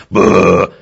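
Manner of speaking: loud threatening boo; a sudden loud dog boo; mock hostile boo. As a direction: bark it at the rival. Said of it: "Instead of going".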